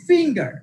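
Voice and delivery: Finger with ফিং high, then falling; first-syllable stress. Shown as a demonstration. Teacher giving a noun example, first syllable of finger stressed. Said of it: "ফিং এগুলোতে pressure দিয়েছি".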